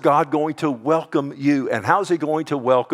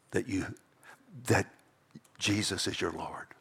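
hushed, breathy whisper of awe; whispered, voiceless; 4 semitones lower, 10 dB quieter than usual. Real, not acted. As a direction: drop to a reverent whisper.

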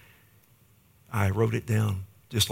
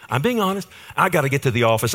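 higher and louder, venting his frustration; frustrated outburst; 5 semitones higher, 5 dB louder, much faster than usual. Retold or imitated. Pastor venting about a drop-in visit, frustrated and loud. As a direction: blurt it out, fed up and exasperated. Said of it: "I was so frustrated".

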